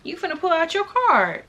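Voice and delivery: mock expectant. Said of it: "So I'm thinking".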